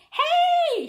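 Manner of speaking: high, loud call from the stands; shout. A narrator's reading. Fan in the stands calling out, loud and high. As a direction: shout across the stadium. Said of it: "shouted a fan in the stands".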